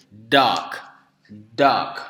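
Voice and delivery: "dog" with a firm voiced d, repeated; English d demo. Shown as a demonstration. Teacher demonstrating the d sound, emphatic "dog". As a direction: model "dog" twice, set apart. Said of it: "Kalau ini d".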